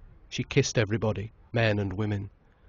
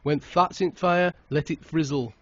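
higher, louder wagging warning; admonishing; 7 semitones higher, 5 dB louder than usual. A narrator's reading. Uncle warning the groom, mock admonishing. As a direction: warn him like a knowing uncle. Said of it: "admonished his Uncle Frank".

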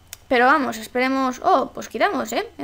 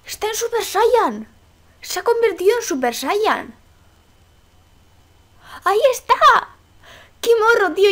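excited outburst; 8 semitones higher than usual. Real, not acted. Teammate turns Super Saiyan.

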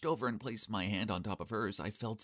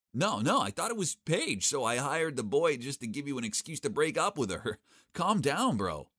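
flustered pleading; 7 semitones higher, 5 dB louder than usual. Acted. Nervously explaining to angry man.

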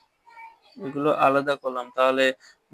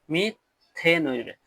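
Methane drawn out slowly; slow demo; much slower than usual. Shown as a demonstration. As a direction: spell out methane slowly for students. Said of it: "উচ্চারণটা একটু ভাঙিয়ে দেয়". (ধীরে ধীরে methane উচ্চারণ)

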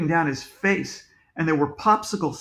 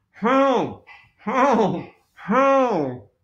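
higher muffled calls for help; muffled cry; 6 semitones higher, 3 dB louder, much slower than usual. A narrator's reading. Trapped man calls to searchers on the roof, muffled. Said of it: "we heard that muffled voice again".

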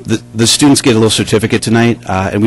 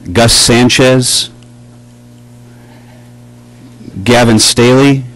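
loud, slow ceremonial roll call of names; 2 semitones higher, 7 dB louder, slower than usual. Acted. Band director calling students up for certificates, loud and slow. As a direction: announce each student into the hall, loud and slow.

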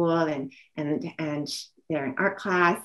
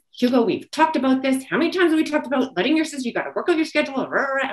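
raised, fast, exasperated scolding of her son; angry scolding; 7 semitones higher, 4 dB louder, faster than usual. Retold or imitated. Frazzled mother scolding her son at the bus stop, angry. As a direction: rip into him, pitch high and pushing. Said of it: "I will rip into Hugo".

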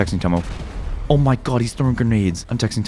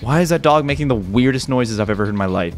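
usual speaking voice

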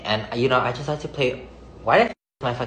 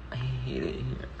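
whispered on a sigh, much softer; breathy whisper; 14 dB quieter than usual. Real, not acted. Homesick in LA, telling viewers, soft whispered sigh. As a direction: let it out as a defeated whisper.

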